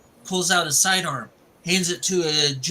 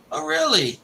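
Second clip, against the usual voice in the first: acted surprise; 12 semitones higher than usual. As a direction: react as if hearing news, pitched high. Retold reaction to news of sniper.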